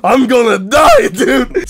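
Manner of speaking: high, loud panicked cry; mock panic, yelling. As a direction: shout it like you're bleeding out. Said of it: "I'm like".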